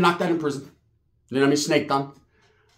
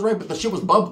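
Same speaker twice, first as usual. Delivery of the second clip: usual speaking voice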